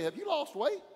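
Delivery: high, bright, sing-song question; mock friend asking. Someone asks if you've lost weight, high mock voice. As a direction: toss it off like a nosy friend noticing.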